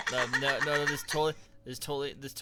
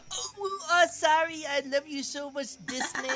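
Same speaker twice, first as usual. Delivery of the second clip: imitating cutesy baby talk, much higher; squeaky sweet switch into uwu; 13 semitones higher, 4 dB louder, slower than usual. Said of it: "you went into a uwu voice very easily".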